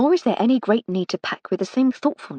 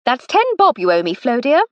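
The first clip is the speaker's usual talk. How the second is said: lightly raised, teasing aristocratic lady; a hint of a fond, mocking older sister; Lady Hardcastle; 5 semitones higher, 6 dB louder than usual. Lady Hardcastle teasing her brother over a bet, lightly raised and playful.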